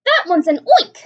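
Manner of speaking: high, loud panicked scream; terrified scream. A narrator's reading. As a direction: shriek it in fright. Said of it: "screamed the head of the fire department".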